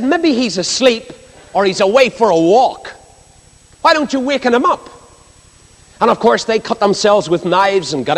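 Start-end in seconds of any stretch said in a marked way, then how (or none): 0.0-4.9 mocking taunt. Elijah jeering at the worshippers of Baal, mocking. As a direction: taunt Baal's worshippers with scorn. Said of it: "he mocked the god Baal".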